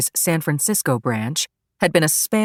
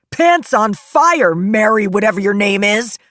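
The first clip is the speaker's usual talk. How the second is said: high, loud courtroom outburst; loud, jeering accusation, pitched way up; angry yell; 6 semitones higher, 5 dB louder than usual. Acted. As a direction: yell taunts across the room at a witness. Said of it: "jumped to his feet again and yelled".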